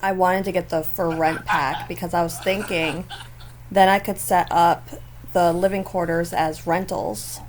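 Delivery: ordinary talk, nothing marked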